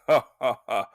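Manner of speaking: mock evil laugh, each "ha" separate; villain's mock laugh. Jay to the men who called him mad, mocking villain laugh. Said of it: "Jay says, he's like".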